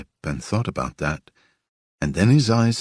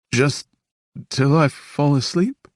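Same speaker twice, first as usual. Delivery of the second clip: put-on high, small pleading child voice; a lonely boy begging him to stay; 9 semitones higher, 4 dB louder than usual. A narrator's reading. Boy begging Evan to stay, pleading.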